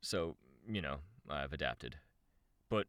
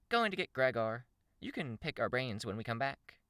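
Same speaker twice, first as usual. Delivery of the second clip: high, lively character voice; female character; 9 semitones higher than usual. Acted. Shamrock leaving to get Gregor, high character voice. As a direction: pitch it up as she heads off.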